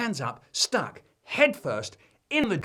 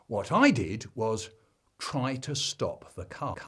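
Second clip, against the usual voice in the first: ordinary delivery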